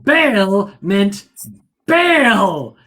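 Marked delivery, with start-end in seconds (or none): 1.9-2.8 loud, higher, drawn-out cry; mock shout. Storyteller acting out crew hearing bail, mock shout. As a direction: belt out the misunderstood order for comic effect. Storyteller mimics the misheard order.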